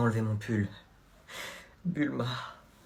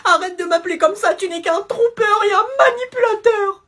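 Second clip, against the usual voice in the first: high, loud sobbing accusation; mock crying; 14 semitones higher, 3 dB louder than usual. Girlfriend accusing the boyfriend of lying, crying.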